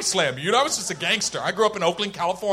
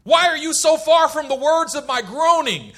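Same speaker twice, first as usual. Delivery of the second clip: loud, raised lament to God; broken-hearted cry; 7 semitones higher, 5 dB louder than usual. Acted. Broken heart crying out to God, loud lament. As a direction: cry out in anguished prayer. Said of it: "remains before the Lord and says".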